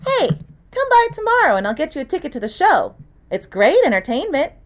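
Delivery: put-on girl's voice, knowing and dry; lightly voiced young girl. A narrator's reading. Girl in the prop room teasing the detective, lightly voiced.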